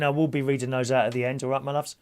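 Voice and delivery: ordinary talk, nothing marked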